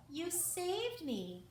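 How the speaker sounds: high, slow and grateful exclamation; exclaimed. A narrator's reading. Rescued mouse thanking Owen, exclaiming high and slow. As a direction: burst out thankfully, drawn out. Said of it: "Sophie exclaimed".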